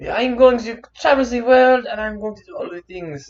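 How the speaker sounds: high, loud proclamation, easing off; grand boyish declaration; boy declaring dreams. Retold or imitated. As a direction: announce big plans like a little superhero. Voices boy on card's big dreams.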